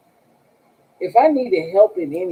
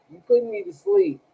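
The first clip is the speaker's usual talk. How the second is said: mock whine; faster than usual. Squeaky mock complaint.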